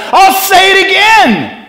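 high, loud mock-stern outburst; insistent shout. Paul answering the mopey believer, shouted insistence. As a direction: override the objection at full voice. Paul overrides the sad objection.

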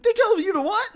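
very high, laughing, half-crying squeal; laughing disbelief. Retold or imitated. Casey told his brother must kill him, high laughing squeal.

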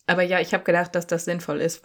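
ordinary delivery

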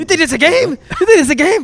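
high-pitched, loud mimicked challenge; mock outburst. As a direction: throw it out as a high, heated taunt. Mimicking a heated outburst on the show.

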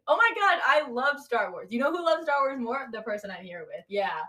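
usual speaking voice

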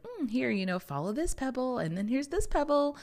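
high, light voice of the universe guiding; universe voicing. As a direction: coax like a gentle guide handing out clues.

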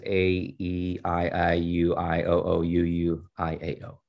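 A, E, I, O, U said as English alphabet letters, evenly spaced; English letter names. A narrator's reading. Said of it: "pronouncing the vowels as letters of the English alphabet".

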